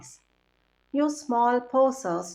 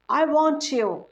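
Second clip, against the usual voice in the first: crocodile declaring; 5 semitones higher, 5 dB louder than usual. A narrator's reading. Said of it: "Crocodile declared".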